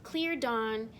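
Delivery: ordinary delivery, nothing marked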